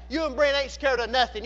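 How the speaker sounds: ordinary delivery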